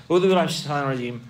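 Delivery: very quick, mechanical "A'udhu billahi minash shaitanir rajeem"; hurried, run-together recitation; tongue-only rote recital. Shown as a demonstration. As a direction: reel off the prayer by habit. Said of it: "You just say it like".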